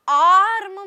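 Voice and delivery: "आर्म" with a very long आ; stretched-out "आर्म"; long vowel. Shown as a demonstration. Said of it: "लंबा pronounce करना पड़ा".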